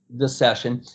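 normal talking voice

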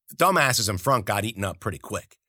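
ordinary delivery, nothing marked

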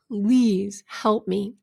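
very slow retold plea; pleading. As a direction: draw out a desperate appeal. Voices man pleading to the sky.

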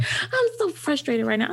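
mock three-year-old frustration; imitating frustrated toddler. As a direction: act out a little kid's earnest complaint. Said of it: "She was about three".

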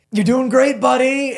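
high, loud mock encouragement; guy cheering Joey on. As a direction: squeal it like a proud cheerleader. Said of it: "And he's like".

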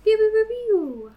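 high zappy laser pews; laser pew sounds. Pretend. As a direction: fire off little laser blasts.

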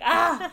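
high, loud mock yelp; mock cry of pain. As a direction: yelp as if the S hurts. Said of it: "I'm like".

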